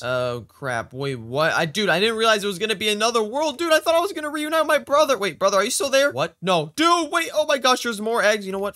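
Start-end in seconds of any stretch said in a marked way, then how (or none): none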